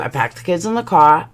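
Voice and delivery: usual speaking voice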